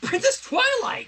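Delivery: high, startled cry of the name; shocked recognition. Acted. Sunset recognising the princess.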